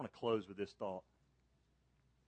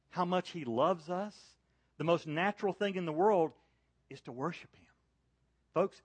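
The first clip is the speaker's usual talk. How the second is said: preaching at full force; 6 semitones higher, 5 dB louder than usual. Real, not acted. Preacher urging listeners who know God to worship. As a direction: lift it into a fervent plea to the church.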